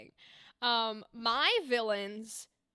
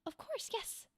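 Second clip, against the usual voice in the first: much higher, much softer dainty lady; dainty woman; 11 semitones higher, 11 dB quieter than usual. Shown as a demonstration. GM voicing a dainty woman NPC, high and soft. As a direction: float it up high and soft, delicate. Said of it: "I might still keep my voice and just go".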